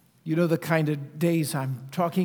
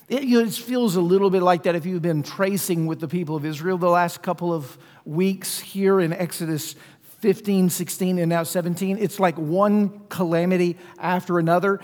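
usual speaking voice

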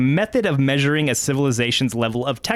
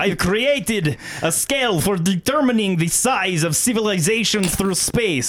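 put-on slow, higher Russian accent; lightly voiced Russian scientist; 7 semitones higher than usual. Kardashev voiced in Russian style.

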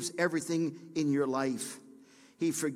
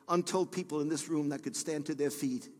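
ordinary talk

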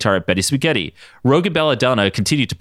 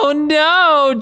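scream; 4 dB louder than usual. Acted. Jean screams in story.

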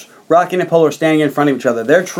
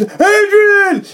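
high calling yell; yelling Adrian; 12 semitones higher than usual. Retold or imitated. Rocky calls for Adrian after the decision, high yell. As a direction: shout her name across the ring. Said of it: "And he's".

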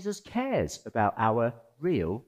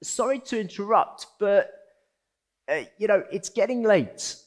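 higher, apologetic, halting plea; hesitant disciple; 8 semitones higher, 4 dB louder than usual. Retold or imitated. Disciples nervously appealing to Jesus about the late hour. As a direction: interrupt him nervously and politely. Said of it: "they appeal to Jesus".

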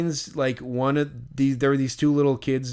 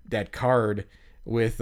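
ordinary talk

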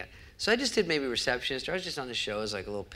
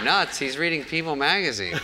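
laughing, higher and louder; laughing disbelief; 6 semitones higher, 5 dB louder than usual. Real, not acted. Laughing at the assistant's claim that things are nuts. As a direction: crack up at how absurd the excuse is.

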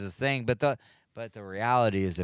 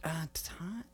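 excited fan voice; 11 semitones higher, 6 dB louder than usual. Acted. Brendan character.

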